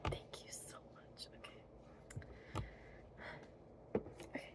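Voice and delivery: breathy unvoiced whisper; fairy whisper. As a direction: whisper as eyes close.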